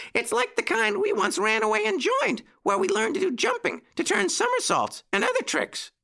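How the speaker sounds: put-on very high, squeaky puppy-boy voice; explaining puppy. A narrator's reading. Petey explains the circus they once joined, very high puppy voice. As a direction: explain it eagerly in a tiny high voice. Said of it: "explained Petey".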